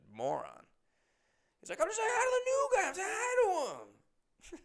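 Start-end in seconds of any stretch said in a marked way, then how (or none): 1.8-4.6 put-on a hurt dopey drunk pleading his case; whiny drunk insisting. Drunk regular pleading to the crowd, high whiny mock voice.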